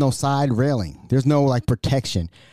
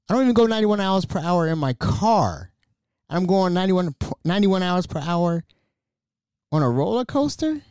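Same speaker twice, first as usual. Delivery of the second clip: incredulous; 6 semitones higher than usual. Real, not acted. Incredulous at the coaster's speed.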